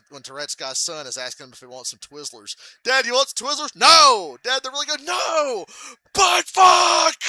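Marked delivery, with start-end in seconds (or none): 2.8-3.7 eager son. Retold or imitated. Son asks about Twizzlers. 3.7-4.4 sharp, much louder refusal; angry shout. Retold or imitated. Dad refusing his son's Twizzlers, shouting. 4.4-5.0 pleading son. Retold or imitated. Son insists. 5.0-7.2 much higher, louder outburst; furious shout. Retold or imitated. Dad punching the table in public, furious shout.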